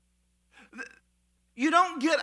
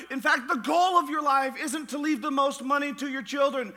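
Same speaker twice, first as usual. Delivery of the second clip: usual speaking voice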